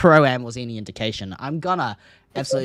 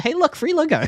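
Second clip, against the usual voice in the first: voicing the team; 3 dB louder than usual. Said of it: "Ground Zero said".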